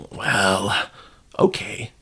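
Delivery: ordinary delivery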